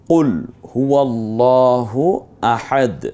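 slow recited আহাদ with the end stopped; কুল হুয়াল্লাহু আহাদ recited slowly; Quran recitation. Shown as a demonstration. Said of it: "আহাদ বলবো".

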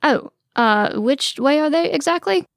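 hesitant, pitch climbing high; a bit confused. A narrator's reading. Prince asks princess where the stairs are, confused. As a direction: ask it lost, rising through the question. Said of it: "he's getting a bit confused".

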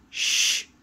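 a hard, voiceless shush; sharp shush. Acted. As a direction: hush him up crossly. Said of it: "shushed Mister Grumble".

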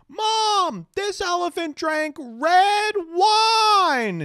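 very high, loud squeal with slow, stretched words; excited little kid. Retold or imitated. Kid reading the elephant sign to mom, squealing excitedly. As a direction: shriek it like a ten-year-old sounding out words. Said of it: "We watch this little kid, maybe ten years old".

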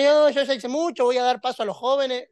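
high, loud put-on voice of a retiree giving up; mock excuse of an older believer. Preacher mocking a retiree who says he did enough, high and loud. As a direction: play a tired older member brushing it off. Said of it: "Así que está pensando".

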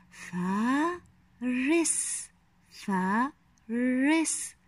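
فارس slowly in two parts, long فا, pause, then رس; very slow فارس, each syllable separate; split into syllables. Shown as a demonstration. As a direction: sound out فارس with a gap between syllables. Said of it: "الآن أحلل الكلمات التالية".

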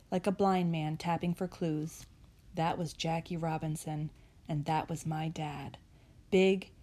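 soft, low storytelling close; hushed, understated tribute; quiet reflection. A narrator's reading.

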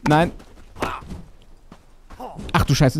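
usual speaking voice

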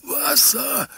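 very high, drawn-out moan; groaning. A narrator's reading. Trapped driver begging again for water, groaning. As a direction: plead in a strained groan. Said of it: "hörte man den Fahrer abermals stöhnen".

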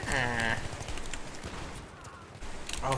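ordinary talk, nothing marked

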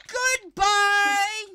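loud, drawn-out send-off; taunting call. Real, not acted. Player waving off tryhard opponents, loud taunting call.

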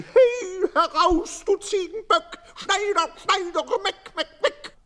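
very high, loud, laughing taunts; mock the tailor like cheeky kids bleating; jeering boys. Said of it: "hört man plötzlich ein Geschrei".

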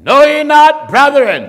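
high, loud preaching cry; preacher's loud call; preaching shout. Acted. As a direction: cry the line out over the church. Preacher repeats scripture, shouting.

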